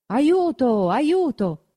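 a priest yelling for help into the night; wailing aiuto from a window; cry for help. A narrator's reading. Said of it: "quel primo sgangherato grido".

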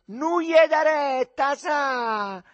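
very high, loud, stretched-out yell; heckling shout. A narrator's reading. Boys jeering at couples on the road, drawn-out shout. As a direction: call it out mockingly, dragging the words. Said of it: "quelli gridavano".

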